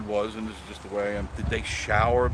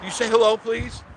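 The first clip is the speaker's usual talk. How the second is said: much higher, sing-out request; coaxing; 12 semitones higher, 6 dB louder than usual. Real, not acted. Asking Miss Marie to greet viewers, high coaxing. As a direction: coax in a high-pitched voice, loud at first.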